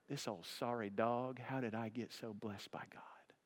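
soft breathy whisper, fading to nothing; whispered prayer. Retold or imitated. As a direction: whisper it, overcome with gratitude. Grandfather's tearful thought at table.

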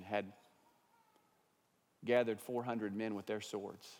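soft, quiet, gentle telling; calm wife. Retold or imitated. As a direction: calmly break the grave news. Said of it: "probably in a very calm manner".

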